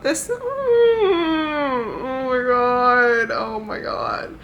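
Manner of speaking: slow moan, high and sinking; overwhelmed wail. Retold or imitated. Reader undone by how he loves her, wailing. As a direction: drag it out like a whimper. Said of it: "And I'm like".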